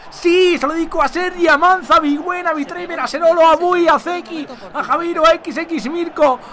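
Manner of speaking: high, excited roll call of names; elated goal dedication. Real, not acted. Streamer dedicates his goal to viewers, elated. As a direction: keep the celebration going through the names.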